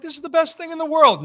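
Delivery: high, quick burst of delight; excited younger self. Retold or imitated. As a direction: gush like a thrilled kid in snow. Acting his delighted teenage self in snow.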